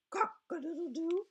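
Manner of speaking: high loud crowing; rooster crow. Acted.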